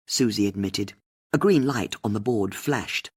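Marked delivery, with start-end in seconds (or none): none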